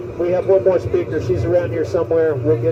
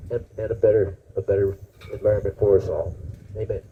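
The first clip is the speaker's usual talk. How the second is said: prayer; 4 semitones lower, 3 dB quieter than usual. Real, not acted. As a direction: lead the crowd in humble prayer. Leads a public prayer.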